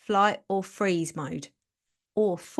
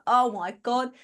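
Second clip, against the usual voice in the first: exasperated adrenal glands; 3 semitones higher, 4 dB louder, slower than usual. Pretend. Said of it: "Your adrenal glands ... is like".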